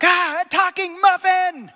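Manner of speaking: high, loud mock shriek of shock; startled shout. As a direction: yelp like a muffin that just heard another one talk. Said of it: "the second one looks at the first and be like".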